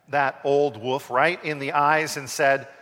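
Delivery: ordinary delivery, nothing marked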